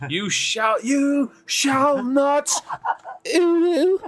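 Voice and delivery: big slow high proclamation, laughing at the end; booming wizard parody. Pretend. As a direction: roar it like a wizard blocking the bridge.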